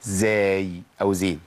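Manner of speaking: "زاي" drawn out with a buzzing z; voiced zay. Shown as a demonstration. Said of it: "لو ما مشيتش النفس في السين هتبقى".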